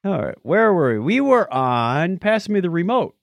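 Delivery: ordinary talk, nothing marked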